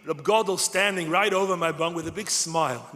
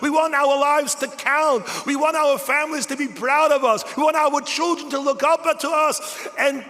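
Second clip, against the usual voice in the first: preaching at full force; 9 semitones higher, 7 dB louder than usual. Acted. Speaker leading the crowd in declaring to God, impassioned preaching. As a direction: proclaim it to the heavens.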